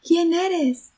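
high echoed question; echoing voice. A narrator's reading. Mountain echo answers the boy's question, high mimicking call. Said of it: "Y la voz contestó".

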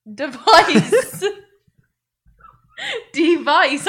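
"Device" with a clear "vice", loud and laughing; laughing, loud "Device" rhyming with "ice"; correct pronunciation. Shown as a demonstration. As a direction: punch out "Device" as dee-VICE, high. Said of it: "Not device".

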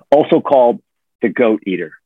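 ordinary talk

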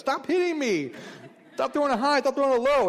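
put-on high, loud complaining kid voice; whiny high kid yelling. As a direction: shout it like a fed-up boy at his dad. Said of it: "they yell at me".

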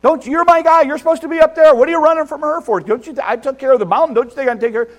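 high, loud, rushing mock tirade; imagined scolding God. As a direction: berate him in exasperation, hammed-up. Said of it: "That's not what God does".

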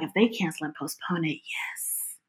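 hushed hopeful whisper. Retold or imitated. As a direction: breathe it like a secret wish. Hopeful secret wish to cancel.

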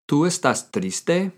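slow repeat rising on triste; teacher repeating the question slowly; slow model question. Shown as a demonstration. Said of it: "How do you ask someone, "Are you sad?".

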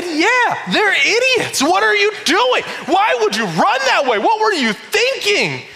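high, loud laughing rant; mock ranting. Pastor answering his wife, high laughing rant at the characters. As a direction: rant at the screen, half-laughing. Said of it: "And I said".

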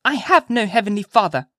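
put-on high, loud child's declaration; defiant little-girl voice; positive cry. A narrator's reading. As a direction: declare it flatly certain, as a child. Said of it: "cried she positively".

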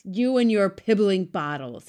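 loud giant's sneer at the bottles; shouted scorn. A narrator's reading. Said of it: "he shouted".

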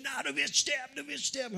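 high-pitched insistent mock yell; mock scream back. Voice in her head shouting down the sick woman, mock scream. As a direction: imitate a voice screaming back, pitched high without full volume. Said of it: "die Stimme hat zurückgeschrien".

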